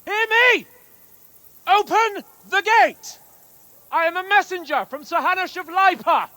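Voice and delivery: high, loud shouts up to the walls; calling out at the gate. Acted. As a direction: hail the guards from below the gate. Calling up to closed castle gate.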